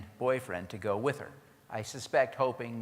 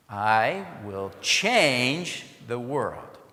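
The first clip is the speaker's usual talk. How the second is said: "change" punched loud, slow and drawn out; stress on CHANGE; 5 dB louder, much slower than usual. Shown as a demonstration. Pledge leader booming the word change slowly. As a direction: make "change" the big word. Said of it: "emphasize a different word".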